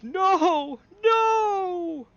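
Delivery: high, loud wailing no from a giantess; anguished giantess cry. Acted. Female giant finding her fallen kin, anguished high cry. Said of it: "and she goes".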